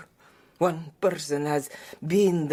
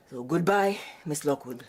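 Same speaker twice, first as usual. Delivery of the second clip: usual speaking voice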